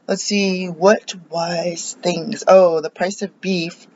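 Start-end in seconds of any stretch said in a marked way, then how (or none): none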